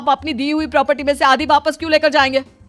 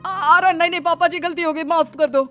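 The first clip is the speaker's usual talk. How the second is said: higher-pitched, anxious pleading; alarmed backpedalling, voice raised in pitch; panicked apology; 5 semitones higher than usual. Acted. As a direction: beg papa for forgiveness. Son begs father-in-law's forgiveness.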